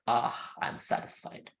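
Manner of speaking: soft, slow, sated sigh; hushed and slow, as after a good meal; acted contented sigh. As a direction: sigh it out slowly, fully satisfied. Krishna sighs, contented after eating.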